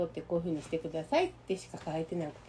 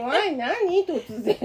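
high, louder mock fright; mock scared; 6 semitones higher, 5 dB louder, much slower than usual. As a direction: act startled and scared. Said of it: "ってなって". (秘書の怯えを大げさに真似る)

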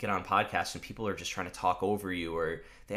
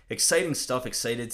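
excited; 5 semitones higher, 6 dB louder than usual. Real, not acted. Host sharing travel news with viewers, bursting with excitement. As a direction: burst out high and loud with real excitement. Upbeat vlog update on plans.

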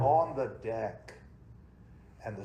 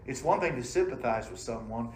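ordinary talk, nothing marked

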